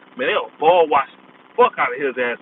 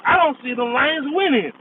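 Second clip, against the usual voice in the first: mock pundit; 10 semitones higher than usual. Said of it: "This is the rest of the media".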